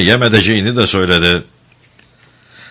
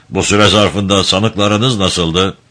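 ordinary delivery

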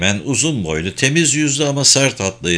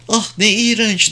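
mild cry out; 12 semitones higher than usual. A narrator's reading. Angela reacting to the hidden bugs, high mild cry. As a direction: exclaim in a much higher voice. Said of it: "diye bağırdı Angela".

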